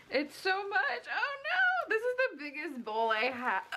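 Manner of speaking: high, half-crying dismay; laughing despair at the mess. Real, not acted. Dough overflows the biggest bowl.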